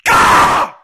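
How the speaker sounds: high-pitched full-throated yell; screaming. Acted. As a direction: scream it out like a tantruming kid. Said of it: "stop screaming".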